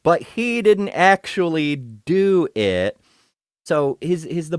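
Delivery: slow, loud mock-innocent drawl; sarcastic. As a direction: say it with heavy irony. Sarcastic defense of Trump.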